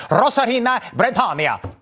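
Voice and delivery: Rosarena Bretonia with rolled, overdone Spanish vowels, mocking; mock Spanish accent. Host mocking anchors who fake Spanish accents, mock Spanish accent. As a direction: parody a newscaster's showy Spanish accent. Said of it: "pronounce it like we're living in Mexico".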